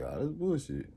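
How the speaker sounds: scoffing. Retold or imitated. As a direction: brush it off under your breath. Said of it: "you like".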